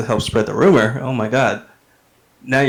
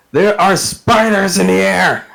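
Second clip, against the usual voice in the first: high, drawn-out panicked-alarm yell; 8 semitones higher, 4 dB louder, slower than usual. Acted. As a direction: yell a slow warning to everyone around. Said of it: "he's just yelling".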